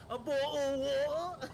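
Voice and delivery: loud, high mock-British accent; hammed-up British accent. Shown as a demonstration. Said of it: "I can do a British accent".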